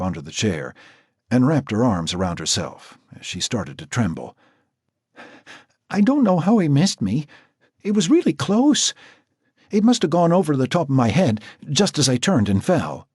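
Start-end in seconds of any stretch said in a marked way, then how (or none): 5.9-13.1 high, loud, frightened; shaken girl. Acted. Marcy trembling as she tells Jack, high and scared. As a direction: relive the shot with fear.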